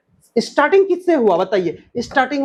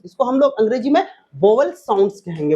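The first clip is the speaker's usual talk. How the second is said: ordinary delivery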